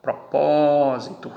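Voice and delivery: teacher slowly marking the stress in Propósito; stress shown. Shown as a demonstration. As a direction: stretch pro-PÓ-sito to show the stress. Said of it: "aqui está a tonicidade".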